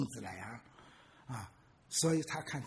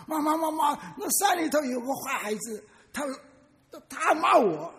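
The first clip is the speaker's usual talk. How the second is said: put-on high, loud tattling child; a boy crying out to his mother about the echo; upset child calling; 10 semitones higher, 9 dB louder than usual. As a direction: run in shouting for mom. Child runs home complaining to mother.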